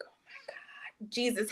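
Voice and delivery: very soft, breath-only retold prayer; whispered exasperated aside; hushed plea. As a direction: mutter a private appeal to heaven. Muttered plea after an absurd claim.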